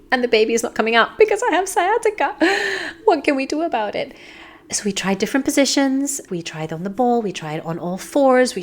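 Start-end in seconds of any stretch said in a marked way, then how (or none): 0.1-4.1 much higher, urgent and pleading; high-pitched anxious appeal to the midwife; distressed plea. Retold or imitated. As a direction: beg the midwife for a way out.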